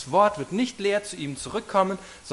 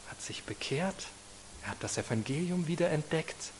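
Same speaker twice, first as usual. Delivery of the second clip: whisper; 10 dB quieter than usual. Real, not acted. Preacher hushes on Luther's conversion.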